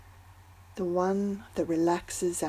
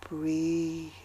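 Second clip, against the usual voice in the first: guided meditation. Acted. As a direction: ease them into calm with long pauses. Leads a breathing meditation.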